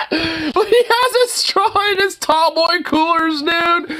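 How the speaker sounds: high laughing disbelief, stretched slowly; cracking up at the straw in the beer can. Real, not acted. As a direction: cackle through the words, can't believe it. Laughing at drunk guy clip.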